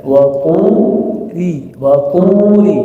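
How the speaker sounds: "turi" chanted slowly with a relaxed, thin ri. Shown as a demonstration. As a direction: draw out tu in "turi", tongue relaxed on ri. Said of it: "harus dirilekskan". ("turi" dilantunkan pelan, ri rileks dan tipis)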